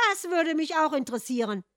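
high, loud interjection; butting in. A narrator's reading. Sister throws in her word, butting in. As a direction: cut in over the others. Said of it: "warf Hans Schwester dazwischen".

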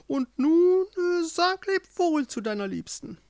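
very high, theatrical witch voice; gloating witch. A narrator's reading. Witch taunts Joringel over the bird, gloating. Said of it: "die Hexe sagte dazu".

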